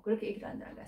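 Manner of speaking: unvoiced, soft repeat; whispered echo. Shown as a demonstration. Preacher whispers his own line back to the congregation. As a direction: breathe the repeat as a hush. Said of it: "repeat whispered".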